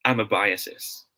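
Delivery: amoebiasis slowly as am-uh-BY-uh-sis; slow model pronunciation. Shown as a demonstration. Teacher drilling the class on the right form, slowly. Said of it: "it should be pronounced as".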